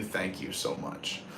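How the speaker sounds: slow, hushed whisper of thanks; sincere whisper to the viewers. Real, not acted. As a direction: breathe the thanks out softly and slowly. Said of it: "repeated thanks, fading".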